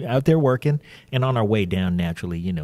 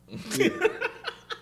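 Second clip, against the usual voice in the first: high squealing laugh; 5 dB quieter than usual. Real, not acted. Laughing at memory.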